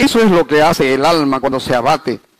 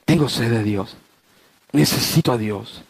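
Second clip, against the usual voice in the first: soft longing; 7 semitones lower, 6 dB quieter than usual. Acted. Pastor's soft longing confession.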